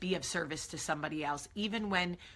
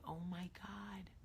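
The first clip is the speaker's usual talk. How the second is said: dropped to a near whisper, much softer; thinking it silently in shock; a hushed, stunned inner voice; stunned inner thought; 14 dB quieter, slower than usual. Retold or imitated. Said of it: "And I'm thinking".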